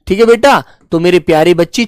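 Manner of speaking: ordinary talk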